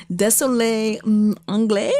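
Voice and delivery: halting tourist French. Retold or imitated. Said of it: "what I would say was".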